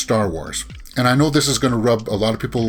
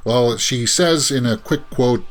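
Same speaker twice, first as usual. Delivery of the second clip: normal talking voice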